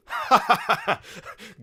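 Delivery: big mocking laugh; loud laugh. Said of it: "a loud laugh".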